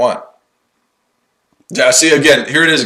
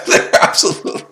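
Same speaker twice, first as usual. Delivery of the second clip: helpless laughter swallowing the words; cracking up. Real, not acted. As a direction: try to speak, dissolve into real laughter. Cracking up at the argument.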